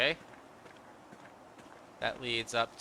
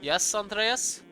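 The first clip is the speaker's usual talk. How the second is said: put-on slow, higher-pitched German-accented question; 6 semitones higher, much slower than usual. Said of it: "I gave him a German accent".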